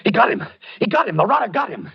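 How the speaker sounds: high, frantic outburst. Acted. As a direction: blurt it out wild-eyed from a nightmare. Wakes wild-eyed from nightmare.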